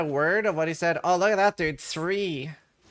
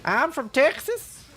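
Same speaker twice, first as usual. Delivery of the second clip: slow, higher hammed-up Texan twang; mock Texan accent; 8 semitones higher, slower than usual. Streamer telling chat in a mock Texan voice. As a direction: drawl it out like a proud cowboy. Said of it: "I was Texan this whole time".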